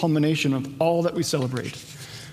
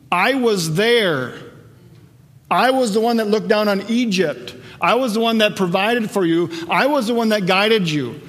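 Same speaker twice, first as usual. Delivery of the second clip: higher and louder, preaching at full force; fervent preaching; 5 semitones higher, 4 dB louder than usual. Acted. Preacher voicing Jesus to the congregation, fervent full-force preaching. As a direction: proclaim it like a revival preacher, building.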